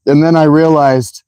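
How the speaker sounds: usual speaking voice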